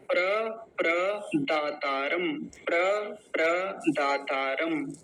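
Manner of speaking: प्र alone, then प्रदातारम slowly drawn out; pronunciation demo of प्रदातारम. Shown as a demonstration. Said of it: "तो ये हुआ उच्चारण". (प्रदातारम का धीमा उच्चारण)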